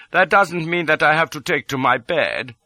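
gentle princely protest. A narrator's reading. Impostor prince declines bed, mild and high. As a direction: lift the pitch for a mild protest. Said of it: "says I, quite gently".

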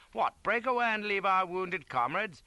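high, loud officer's protest; indignant shock. A narrator's reading. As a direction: act appalled at a subordinate's plan. Officer feigns shock at plan.

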